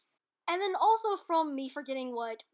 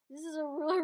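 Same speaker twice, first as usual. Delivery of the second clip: usual speaking voice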